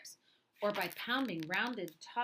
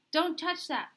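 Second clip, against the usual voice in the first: sharp warning, higher and louder; 8 semitones higher, 5 dB louder than usual. Acted. Papa warning young Louie off his tools, sharp. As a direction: stop the child's hand with a sharp warning. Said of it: "Papa warned".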